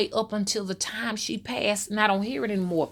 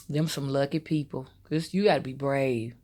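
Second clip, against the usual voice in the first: ordinary delivery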